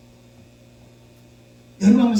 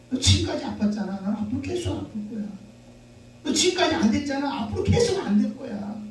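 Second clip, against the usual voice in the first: inner critic taunting; 7 semitones higher than usual. Retold or imitated. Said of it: "자기가 자기에게 뭐라고 말하냐면".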